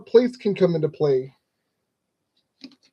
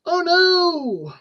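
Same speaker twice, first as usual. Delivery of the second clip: mock dismay; 9 semitones higher than usual. Realises pieces are missing.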